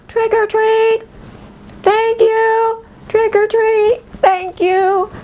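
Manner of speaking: put-on high singsong child voice; gleeful trick-or-treater. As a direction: chirp it at the door like a small kid. Playing herself as a child again.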